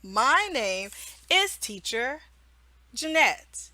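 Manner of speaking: "My name is Teacher Jeanette" spoken slowly and clearly, word by word; slow, clear teacher intro. Shown as a demonstration. Teacher introducing herself to a child, slow and clear. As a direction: spell it out slowly for a young learner. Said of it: "Do you notice?".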